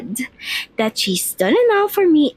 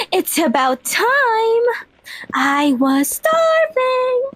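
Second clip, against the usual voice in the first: excited; 8 semitones higher, slower than usual. Acted. Hungry girl greets the meal.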